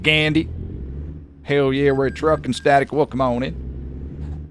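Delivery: hammed-up American trucker drawl; mock American accent. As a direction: put on an exaggerated American accent. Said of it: "Americans would definitely be like".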